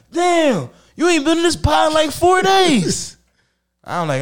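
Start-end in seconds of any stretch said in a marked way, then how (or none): 0.1-3.1 high, loud, slow whiny complaint; high drawn-out kid complaint; kids complaining. Retold or imitated. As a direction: stretch out four days like a sulky kid. Kids complaining he's been away.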